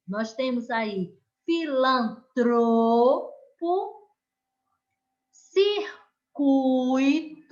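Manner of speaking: pause, then punch the stressed syllable of each word; teacher reading a word list with emphasis; stressed syllable demo. Shown as a demonstration. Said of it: "eu aumento lá o tom".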